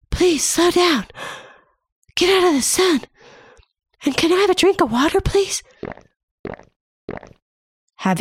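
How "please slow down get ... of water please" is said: much higher, pleading little voice; tiny body voice begging for water and shade; overheated body voice. Acted. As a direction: rush the plea like a thirsty body. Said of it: "That is your body saying".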